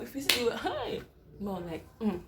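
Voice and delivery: ordinary talk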